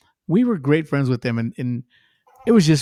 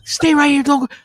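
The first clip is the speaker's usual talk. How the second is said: frantic imitated fan, loud and high; high, excited retold yelling; mock screaming; 5 dB louder than usual. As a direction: urgently beg someone not to leave. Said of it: "He's screaming".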